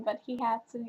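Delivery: usual speaking voice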